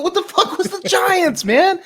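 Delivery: very high-pitched, half-laughing disbelief; incredulous outburst. Real, not acted. Co-host baffled by the giants scene, incredulous outburst. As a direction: burst out high, amused and baffled.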